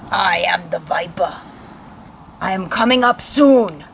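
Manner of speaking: slow, menacing phone voice; creepy villain voice. Acted. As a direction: draw it out like a stalker. Viper on the phone again.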